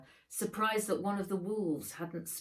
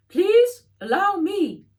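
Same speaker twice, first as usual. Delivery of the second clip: much higher, louder, slow put-on voice; Fred's voice; 14 semitones higher, 14 dB louder than usual. A narrator's reading. Fred rushing in to help over the dying aunt, high slow voice. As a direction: draw it out in a high fussy character voice.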